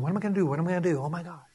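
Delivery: softly fretting to oneself; low-key anxious mumble, trailing away; worried muttering. Acted. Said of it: "and wondering".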